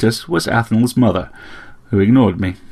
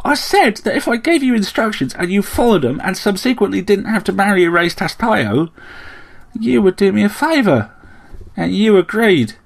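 high-pitched argumentative bird voice; insistent, self-justifying sparrow; 10 semitones higher than usual. A narrator's reading. Sparrow defends the bargain to Slayle, insistent. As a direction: argue the case pointedly, high in pitch.

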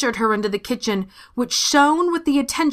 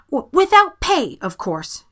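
quickly added; 6 semitones higher, 3 dB louder than usual. A narrator's reading. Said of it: "she quickly added".

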